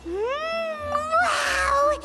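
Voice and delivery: high, drawn-out hum swelling into a wow; impressed amazement. Acted. Student sniffing the extracted flavors for the first time, amazed. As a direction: marvel at it, pitched high.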